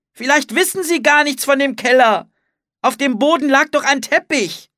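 high, loud urgent reasoning; shouted argument. A narrator's reading. Anne fears they missed the cellar, shouting urgently. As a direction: keep arguing at a shout, pitched high. Anne argues on as tornado approaches.

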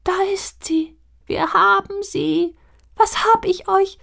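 high, airy little voices calling out in delight; tiny mist spirits cheering; breathless high glee; joyful calling. A narrator's reading. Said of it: "ein freudiges Rufen und Singen".

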